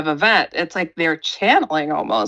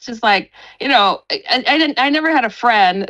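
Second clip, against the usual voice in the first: usual speaking voice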